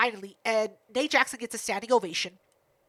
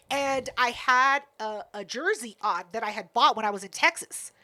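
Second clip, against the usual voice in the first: ordinary talk, nothing marked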